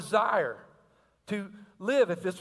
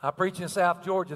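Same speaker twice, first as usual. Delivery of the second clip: ordinary talk, nothing marked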